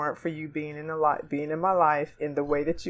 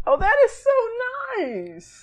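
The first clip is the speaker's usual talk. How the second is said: touched and moved; slower than usual. Real, not acted. Moved by friend's letter.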